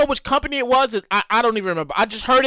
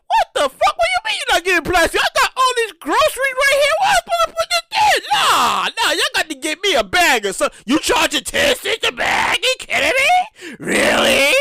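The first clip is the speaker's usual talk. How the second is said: high, indignant mock rant; outraged shopper; 7 semitones higher than usual. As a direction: shoppers reacting to the plastic bag ban, indignant and high. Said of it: "and people was like".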